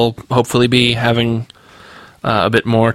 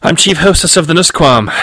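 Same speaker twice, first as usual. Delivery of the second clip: higher, self-assured retort; countering; 7 semitones higher than usual. A narrator's reading. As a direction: counter with official pride. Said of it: "Catherine countered".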